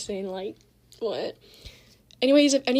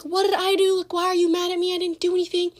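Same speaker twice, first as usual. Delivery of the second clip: high, louder whine of protest; mock yelling; 7 semitones higher, 5 dB louder than usual. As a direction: imitate her yelling in a high voice. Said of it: "she started, like, yelling at me".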